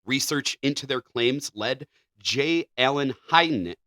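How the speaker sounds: usual speaking voice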